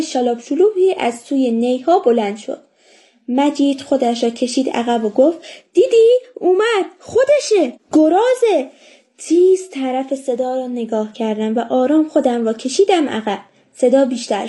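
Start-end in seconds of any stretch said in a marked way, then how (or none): 5.7-8.7 high-pitched startled warning, word by word; mildly acted alarm, pitch jumping up. A narrator's reading. Boy thinks the boar is coming.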